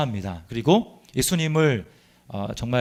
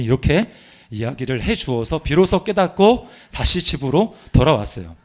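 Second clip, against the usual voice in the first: ordinary talk, nothing marked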